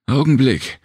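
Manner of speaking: high, urgent call out; shout. A narrator's reading. Serena stopping Jem before he pulls again, high shout. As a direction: stop them with a sharp high cry. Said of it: "schrie Serena".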